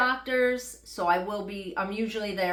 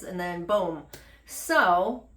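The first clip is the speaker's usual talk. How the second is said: ordinary talk, nothing marked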